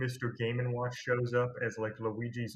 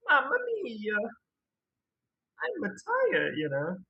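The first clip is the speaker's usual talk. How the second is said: very high, louder Italian-cartoon Mario; Mario impression; 11 semitones higher, 4 dB louder, slower than usual. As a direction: ham up a weary Mario. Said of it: "even I can do better Mario impressions".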